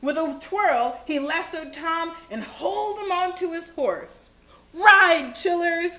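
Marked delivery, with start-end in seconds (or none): 4.7-6.0 loud, high storybook command; rousing shout, strongest at the start; urgent cry. A narrator's reading. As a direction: holler it to hurry the riders. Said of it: "cried Grandude".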